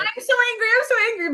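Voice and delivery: high, loud mock tantrum on the edge of laughter; mock anger. Retelling venting alone in the car, mock anger. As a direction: play at being furious, hammed-up. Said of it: "be as angry as I can".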